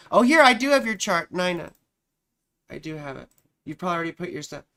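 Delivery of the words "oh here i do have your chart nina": loud, higher burst of relief; surprised relief. Real, not acted. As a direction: blurt it out as you spot it. Finds the lost chart.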